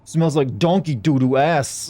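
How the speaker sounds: slow, loud nerd rant voice; Angry Video Game Nerd. Retold or imitated. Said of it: "in the angry video game nerd voice".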